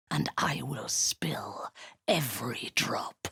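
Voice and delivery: whispered promise of bloodshed; menacing whisper. Acted. Drow warrior threatening a captive, in a whisper. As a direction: breathe the threat right at their ear.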